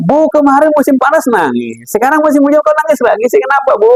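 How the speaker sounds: high-pitched, hurried, puzzled questioning; baffled neighbour. Acted. Neighbour questions mother crying in both seasons, acted bafflement. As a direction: play a neighbour who cannot make sense of it. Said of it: "Tetangganya bingung".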